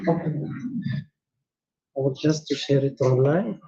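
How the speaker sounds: ordinary delivery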